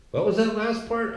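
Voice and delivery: high-pitched heckle from the back; calling from the crowd. Acted. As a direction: call it out like someone who missed it. Acted audience member calling out.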